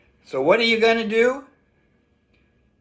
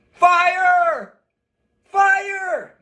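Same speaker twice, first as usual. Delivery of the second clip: yell fire; 12 semitones higher, 4 dB louder than usual. Shown as a demonstration. Child being led away yells fire, building to a loud shout. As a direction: shout it louder each time to draw a crowd. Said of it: "is to yell".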